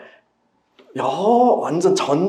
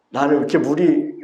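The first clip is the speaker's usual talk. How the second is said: ordinary talk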